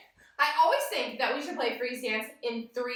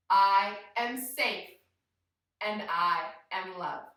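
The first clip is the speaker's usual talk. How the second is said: slow, deliberate chanted affirmation, falling lower; calm mantra; 4 semitones lower, slower than usual. Shown as a demonstration. Teacher saying the mantra with kids, slow and calm. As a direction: lead them slowly, settling down on each phrase. Leads mantra three times together.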